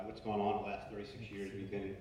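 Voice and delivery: normal talking voice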